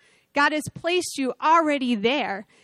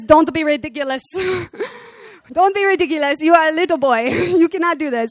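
louder, higher mock villain accent, dismissive, breaking into laughter; king scoffing at the boy in a Gru voice; Gru from Despicable Me; 4 semitones higher, 5 dB louder, faster than usual. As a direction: scoff at the boy like a cartoon supervillain. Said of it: "how I picture his voice, kinda like Crew from, from, uh, Despicable Me".

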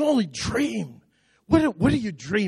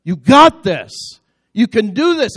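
encouraging; 3 semitones higher, 7 dB louder than usual. Real, not acted. Preacher encouraging congregation loudly.

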